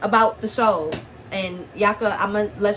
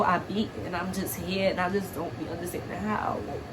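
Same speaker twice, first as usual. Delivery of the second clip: choked up; 2 semitones lower, 8 dB quieter than usual. Real, not acted. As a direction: fade out, overwhelmed and near tears. Breaking down over what she survived.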